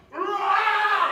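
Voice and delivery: a big, high, loud mock roar; big lion roar. Daddy lion's third and biggest roar at the cub. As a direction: give the biggest roar the jungle ever heard. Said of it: "the daddy lion gave a big one".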